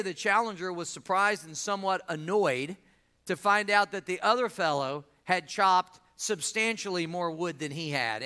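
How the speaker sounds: ordinary delivery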